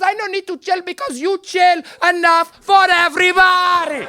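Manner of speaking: put-on high, slow accented shout; hammed-up indignant mom, every word stretched; angry immigrant mother. Mother throws son's word back at him, high accented shouting. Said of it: "in her anger".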